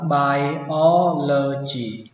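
"biology" drawn out very slowly with a long I, bai-OL-o-gee; slow model reading. Shown as a demonstration. Teacher slowly models "biology" for learners. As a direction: stretch "biology" slowly, I as in eye. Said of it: "Âm y ở đây đọc là I".

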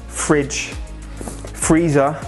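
usual speaking voice

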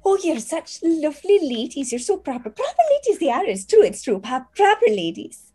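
high, bright flattering chatter; a young maid fawning over guests; gushing teen maid. Acted. GM voicing a maid praising the guests, high-pitched.

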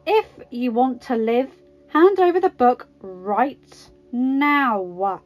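slow, loud, drawn-out threat; demanding. A narrator's reading. Natasha advancing with her pistol on Karpov, demanding. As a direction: demand it forcefully, stretching the final words. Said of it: "Natasha demanded".

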